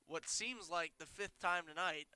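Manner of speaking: normal talking voice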